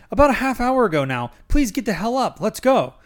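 high, loud, exasperated urging. A narrator's reading. Narrator to groggy Anthony through the bathroom door, exasperated. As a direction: urge a groggy man up, pitched high and forceful.

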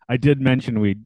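ordinary talk, nothing marked

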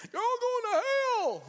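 high, loud mock street-preacher yell; street preacher shouting. Street preacher condemning passersby, hammed-up yell. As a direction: rant like a sidewalk doomsayer. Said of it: "one of those people that stands up on the cardboard box".

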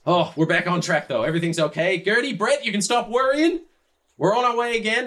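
high, loud calling out; jubilant yelling. Acted. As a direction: holler the good news to the animals. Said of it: "as all the yelling starts".

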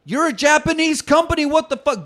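mock indignant yell at the sticker; incredulous outburst. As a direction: shout it like you can't believe it. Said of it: "I'm like".

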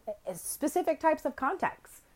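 usual speaking voice

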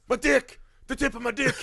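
high, pained wail; a bitten dad cries out to his wife, high wail; imitated pained scream. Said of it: "He's screaming".

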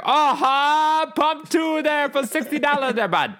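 put-on very high, loud Northern Ontario accent; hammed-up Northern Ontario yokel call. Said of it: "That's Northern fucking Ontario".

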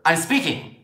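firm interruption. Acted. Speaker to interrupting mother, firm cut-in. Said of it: "I say to her".

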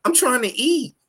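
mock-offended diner complaining to Grandpa; incredulous complaint. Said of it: "I'm gonna be like".

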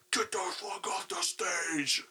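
whispered threat. Retold or imitated. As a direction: hiss it under your breath. Owner voiced in story.

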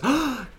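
sharp high gasp of delight; excited toddler gasp. Retold or imitated. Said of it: "she goes".

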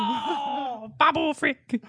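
high, laughing fan excitement; delighted cheer. Real, not acted. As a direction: cry out the name, high and gleeful. Joins in cheering the pick.

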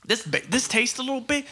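usual speaking voice

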